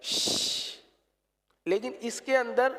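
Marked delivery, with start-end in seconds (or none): 0.0-0.8 ش as an open hiss with all the air pushed out; whispered ش, all breath escaping; Urdu Sheen hiss. Shown as a demonstration. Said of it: "Urdu کے شین میں ساری آواز باہر نکل جاتی ہے". (اردو والا ش، ساری ہوا باہر)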